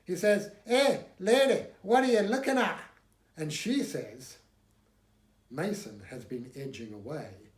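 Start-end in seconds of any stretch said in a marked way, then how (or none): 0.6-2.8 put-on high, loud hot-headed Corsican accent. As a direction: snap at the lady in a Corsican accent. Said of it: "excuse my Corsican accent".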